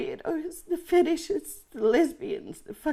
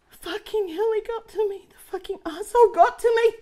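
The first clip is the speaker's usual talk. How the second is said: higher, louder, faster angry shout; furious outburst; 8 semitones higher, 6 dB louder than usual. Acted. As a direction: explode at the man who got to her. Breakdown over leaked pic.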